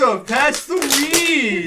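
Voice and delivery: put-on teen hiding from mom. Friend imitating teens when a mom calls, mock casual. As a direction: drag it out like nobody's listening. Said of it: "we would all be like".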